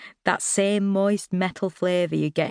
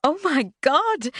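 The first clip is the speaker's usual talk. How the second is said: slow, high, delighted gasp of words; delighted surprise; 11 semitones higher, slower than usual. Acted. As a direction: stretch it out in happy shock. Recognises him, delighted shock.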